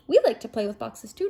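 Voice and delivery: ordinary delivery, nothing marked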